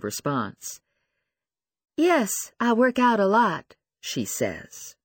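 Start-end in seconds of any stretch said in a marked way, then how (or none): 2.0-3.8 higher, louder voice; insecure young woman. Acted. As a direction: answer up high. Lucy voiced.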